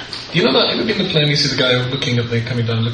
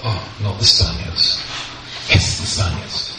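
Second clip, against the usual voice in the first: weary dread; 6 semitones lower, slower than usual. Retold or imitated. Inner groan at noisy neighbours.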